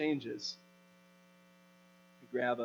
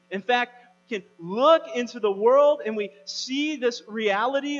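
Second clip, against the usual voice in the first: loud, high, driving sermon delivery; impassioned raised-voice preaching; preaching at full force; 8 semitones higher, 8 dB louder than usual. Real, not acted. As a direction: build a forceful list from the pulpit, punching key words.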